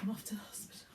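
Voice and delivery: soft whispered aside; hushed goodbye; whispered sign-off. Real, not acted. Signing off the update.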